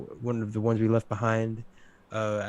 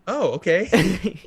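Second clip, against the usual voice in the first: put-on high, drawn-out kid's reply; a puzzled little kid's slow, higher-pitched okay; second-grader self; 7 semitones higher, 4 dB louder, much slower than usual. Imagining his second-grade self hearing the joke, childlike. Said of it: "second grader me just being like".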